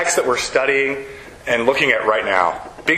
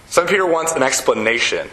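normal talking voice

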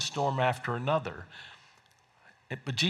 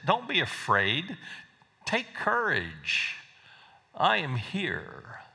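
gentle reassurance; 3 semitones higher, 3 dB quieter, slower than usual. Acted. Jesus calms frightened disciples.

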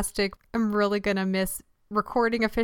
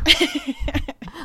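high laugh; 7 semitones higher than usual. Real, not acted. Laughs at her own analogy.

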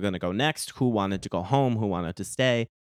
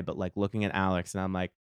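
usual speaking voice

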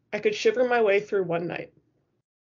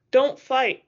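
higher, louder plea; pleading; 4 semitones higher, 6 dB louder than usual. A narrator's reading. Shivering girl to two quarrelling rivals, pleading. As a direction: plead with them to stop. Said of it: "I pleaded".

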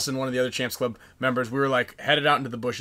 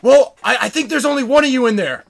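high, loud mock skeptical reply; imitated hollered answer; retold doubtful call-back; 9 semitones higher, 9 dB louder than usual. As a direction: shout your doubt across the field. Enemies call back doubting him.

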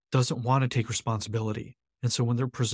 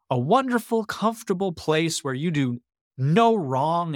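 higher-pitched, ironic praise; mock admiration; 4 semitones higher than usual. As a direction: sell it sarcastically as a lovely place. Mocking the comfort of victimhood.

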